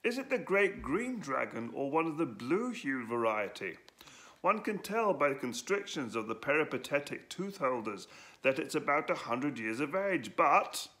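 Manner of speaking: long-winded know-it-all droning on; a light, pedantic droning Edwo; boring voice. Acted. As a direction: lecture on and on to bore the dragon. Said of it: "he went on and on and on in his boring voice".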